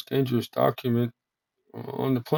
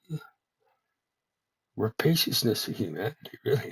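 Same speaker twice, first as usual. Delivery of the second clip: usual speaking voice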